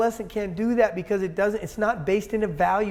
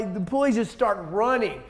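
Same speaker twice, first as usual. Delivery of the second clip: usual speaking voice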